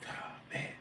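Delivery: whispered oh man; doubting whisper. Retold or imitated. Doubting the jump to himself, a whisper. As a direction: breathe it out unvoiced, doubting.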